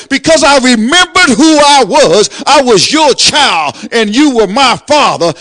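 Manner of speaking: loud, building preacher's cry; preaching at full force. Acted. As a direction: lift it up like a Sunday climax.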